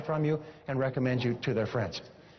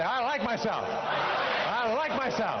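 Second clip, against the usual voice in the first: higher and slower, proudly declaring it; enthusiastic affirmation; 8 semitones higher, much slower than usual. Shown as a demonstration. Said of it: "let's take our thumbs out of our mouths".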